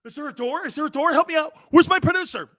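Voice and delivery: rising hammed-up panic, calling for help; mock panic. A host pretending to be locked in a ward, mock panic.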